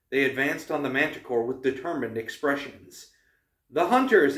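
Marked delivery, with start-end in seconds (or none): 3.7-4.4 higher, louder call; excited cry. A narrator's reading. Annabeth spots the archers arriving, crying out. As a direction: cry it out in sudden recognition. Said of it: "Annabeth cried".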